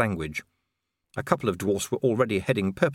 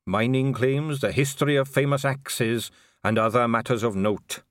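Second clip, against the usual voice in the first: lilting, measured dwarf voice; dwarf record keeper; 5 semitones higher than usual. Acted. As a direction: list the records as a proud archivist. Unknown dwarf voice behind Vimes.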